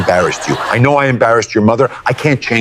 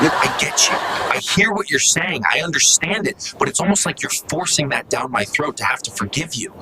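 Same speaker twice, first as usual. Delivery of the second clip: quiet; 4 semitones higher, 7 dB quieter than usual. Acted. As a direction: pull right back and speak softly. Son calms down, speaks slower.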